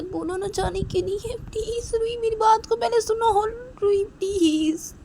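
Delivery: husband begging his wife to hear him out; desperate pleading. Acted. Man pleads with Ruhi.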